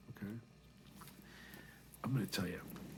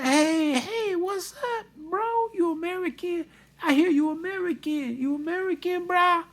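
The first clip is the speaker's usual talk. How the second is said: Vietnamese student impression; 8 dB louder than usual. Student greeting his teacher on the golf course, high loud impression. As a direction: imitate an excited guy hailing a bro. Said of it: "He's like".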